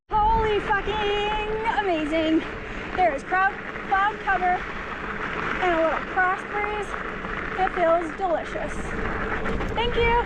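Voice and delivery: high, gushing delight; ecstatic. Real, not acted. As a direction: swoon over the cool breeze. Ecstatic about cool breeze while riding.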